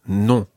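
Non with a full nasal vowel; nasal French non. Shown as a demonstration. Teacher models the nasal sound in Non. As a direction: hum the Non through the nose. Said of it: "a nice nasal sound".

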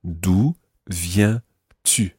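"D'où ... viens ... tu" word by word, very slow; careful vowel sounds. Shown as a demonstration. Teacher tells learner to watch vowels, "D'où viens-tu" slowly word by word. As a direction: separate "D'où", "viens", "tu" with long pure vowels. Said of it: "just watch your vowel sounds there".

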